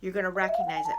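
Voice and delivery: ordinary talk, nothing marked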